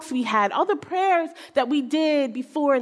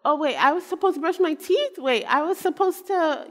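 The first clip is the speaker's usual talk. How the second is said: put-on higher-pitched, scatterbrained kid voice, questions rising; absent-minded son; 7 semitones higher than usual. Mother playfully imitating her forgetful son, absent-minded and high. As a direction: imitate a forgetful boy catching himself mid-thought, pitched up. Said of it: "a little absent-minded. He kinda just lives the world like".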